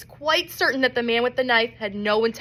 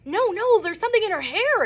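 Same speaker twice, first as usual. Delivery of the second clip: shouted; 9 semitones higher than usual. A narrator's reading. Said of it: "another person shouted".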